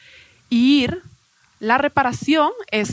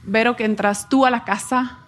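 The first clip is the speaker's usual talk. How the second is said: ordinary talk, nothing marked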